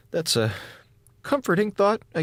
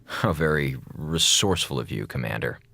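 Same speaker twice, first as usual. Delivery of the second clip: dry sarcasm; 13 semitones lower, 3 dB quieter than usual. Acted. Sarcastic reply in brig.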